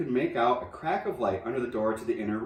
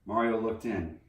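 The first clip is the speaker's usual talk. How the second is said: usual speaking voice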